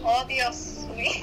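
drawn-out altered reaction; slowed dramatic gasp. Acted. Friend reacting to the lie, slowed dismay. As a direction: stretch the dismay out slowly.

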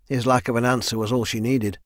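ordinary delivery, nothing marked

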